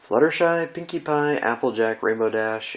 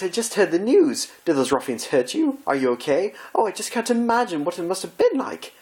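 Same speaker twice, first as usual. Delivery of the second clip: high, theatrical, fretting lady; high-pitched posh pony gushing over a friend; fussy Rarity voice; 7 semitones higher than usual. Acted. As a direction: gush with dramatic concern like a refined fashionista.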